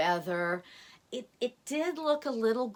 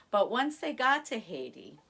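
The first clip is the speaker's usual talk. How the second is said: usual speaking voice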